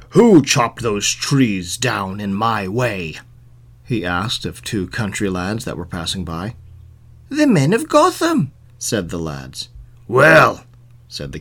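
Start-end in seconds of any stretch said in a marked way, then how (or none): 0.1-3.2 slow, low, stern demand; angry king. A narrator's reading. Angry king questioning two country lads, slow, stern demand. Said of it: "he was very angry". 7.3-8.5 high-pitched lads' answer; country lads. A narrator's reading. Two country lads answering the king, high-pitched. 10.1-10.6 loud, angry single word; angry king. A narrator's reading. King reacting to the lads' answer, loud and angry. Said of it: "he was very angry".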